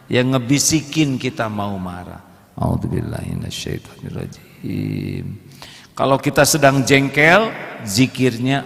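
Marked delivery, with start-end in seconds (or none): 2.5-5.2 softly. Shown as a demonstration. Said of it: "Pelan, ya".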